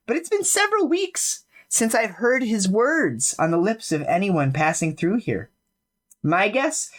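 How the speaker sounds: a friendly local sharing gossip; musician-loving local, lightly voiced; chatty NPC. GM voicing NPC.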